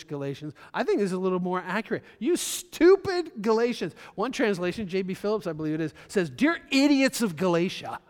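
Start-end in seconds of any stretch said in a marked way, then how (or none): 2.2-3.9 high, loud, slow outraged rebuke; ticked-off Paul. Retold or imitated. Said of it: "You get the feeling Paul's ticked". 6.3-8.0 loud, mocking "Dear" then scorn; ticked-off rebuke. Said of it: "You get the feeling Paul's ticked".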